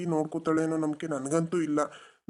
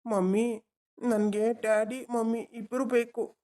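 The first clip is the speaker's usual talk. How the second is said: put-on higher, childlike voice for the son; little boy; 5 semitones higher, slower than usual. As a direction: play the small boy tugging at his dad. Adult voicing the child. (ಪುಟ್ಟ ಹುಡುಗನ ಧ್ವನಿ)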